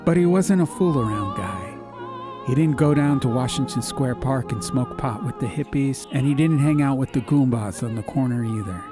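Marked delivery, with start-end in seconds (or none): none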